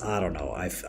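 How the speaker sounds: ordinary talk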